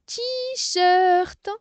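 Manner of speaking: "T-shirt" high, loud and drawn out; teacher stretching both syllables of "T-shirt"; stretched syllables. Shown as a demonstration. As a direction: hold "T" long, then a long "shirt". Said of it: "on fait toujours les syllabes".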